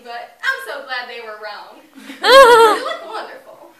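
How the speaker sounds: loud, swooping small-town flattery; gushing church lady. Acted. Church lady fussing over an acquaintance at church, gushing with big laughs. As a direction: squeal and laugh through the compliments.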